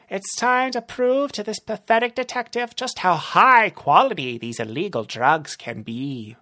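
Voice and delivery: villain urging on his men; gloating villain. Acted. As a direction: cheer the henchmen on. Narrator voices Rumble.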